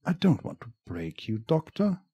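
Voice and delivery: creepy villain voice. Acted. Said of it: "you have a good, creepy, uh, villain voice".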